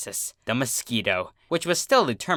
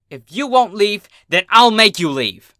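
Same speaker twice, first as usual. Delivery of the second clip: higher, much louder furious threat; angry exclamation; 9 semitones higher, 9 dB louder than usual. A narrator's reading. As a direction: threaten the pest at full volume. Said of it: "she exclaimed".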